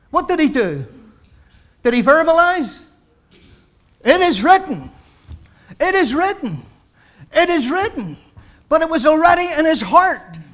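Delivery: pulpit-pounding sermon climax; full-force preaching. Real, not acted. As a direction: preach it out with rising fire to the congregation. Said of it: "I'm preaching again".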